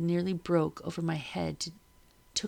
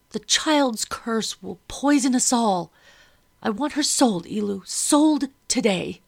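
angry demand; 5 semitones higher, 7 dB louder than usual. A narrator's reading. Madam demands the child sold.

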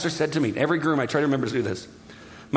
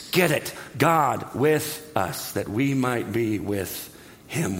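word by word, dropping low; slow, deliberate emphasis; 4 semitones lower, much slower than usual. Acted. God urging you and me to get it, slow deliberate delivery. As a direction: spell out the message slowly.

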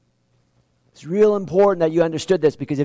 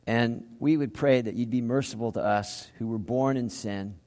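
prayer; 6 semitones lower, 10 dB quieter than usual. Acted. Preacher closing the sermon, praying softly to God. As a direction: pray quietly to the Father.

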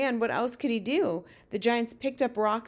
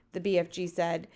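plain reading voice

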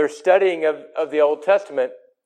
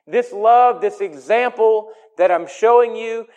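full force preaching; 6 semitones higher than usual. Acted. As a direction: drive the point home to the congregation.

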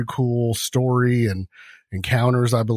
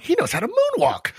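much higher, awed coworker voice; impressed coworker. Retold or imitated. Coworkers watching him moonwalk out, much higher and awed. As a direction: sound amazed by the guy. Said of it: "they'll be just like".

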